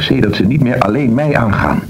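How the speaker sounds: ordinary talk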